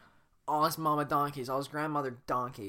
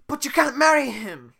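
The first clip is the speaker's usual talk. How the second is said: pleading shout; 13 semitones higher, 15 dB louder than usual. Acted. Shrek pleads loudly.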